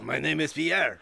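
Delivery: slow put-on French accent. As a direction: introduce a mock Frenchman with a light accent. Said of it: "my accent changes".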